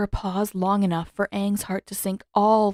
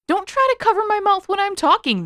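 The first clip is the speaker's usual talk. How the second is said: loud, high indignant comeback; indignant retort; 13 semitones higher, 6 dB louder than usual. A narrator's reading. Sokka to Katara, indignant loud comeback. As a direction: fire it back at her.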